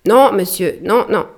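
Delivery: loud high call to the far deck; calling through cupped hands. Acted. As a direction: shout back through hands as a megaphone. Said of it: "mit ses mains en porte-voix et cria". (crier les mains en porte-voix)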